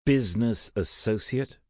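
mildly disdainful query; scornful. A narrator's reading. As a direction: question it with a curled lip. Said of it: "Graham's lip curled".

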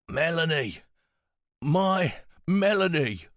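put-on high, drawn-out old man's call; frail old man. A narrator's reading. Pritch recognizing Melanie, high frail old voice.

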